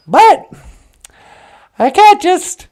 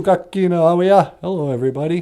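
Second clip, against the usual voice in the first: much lower, odd voiced character; low puppet voice; 10 semitones lower, 5 dB quieter, faster than usual. Puppet answering Natasha, deep voiced character.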